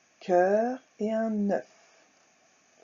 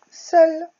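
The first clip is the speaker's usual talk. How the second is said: "Seul" called out much louder and higher; loud stressed word; 9 semitones higher, 13 dB louder than usual. Shown as a demonstration. Teacher to pupils, "Seul" called out loud. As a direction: ring out "Seul" for the class.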